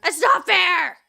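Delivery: loud, high cry of unfairness; outraged protest. Real, not acted. Gamer protesting a cheating shark, high and loud. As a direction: wail at the game's injustice.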